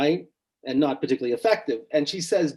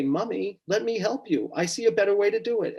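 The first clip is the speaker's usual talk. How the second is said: higher-pitched imitation of an eager little girl; lightly voiced small child, pitched up; seven-year-old girl; 8 semitones higher than usual. Little girl offering her mother help, high child voice. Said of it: "I'm this seven-year-old girl".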